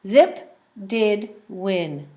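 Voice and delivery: slow, word by word; slow reading. Shown as a demonstration. Teacher reading the win page slowly for the child. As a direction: read the three words slowly. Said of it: "Three words".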